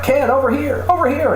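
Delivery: high calling out; mock photographers calling. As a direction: yell it like a photographer.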